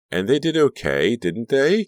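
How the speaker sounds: high, loud, lilting comeback; playful retort. A narrator's reading. Caroline defends her joke name, playful. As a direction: toss it back brightly, rising.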